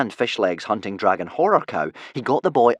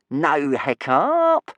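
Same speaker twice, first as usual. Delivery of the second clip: very slow, higher, oily taunt; purring; 6 semitones higher, 4 dB louder, much slower than usual. Acted. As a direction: draw the name out silkily. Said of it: "purred Snotlout".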